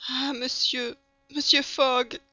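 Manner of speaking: put-on young woman's high, grateful outcry; high heartfelt exclamation; emotional cry. A narrator's reading. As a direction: cry out to him, pitch and volume rising. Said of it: "s'écria la jeune femme".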